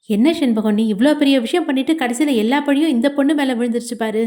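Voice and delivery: usual speaking voice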